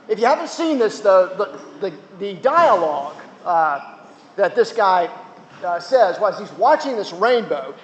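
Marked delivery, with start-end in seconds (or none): none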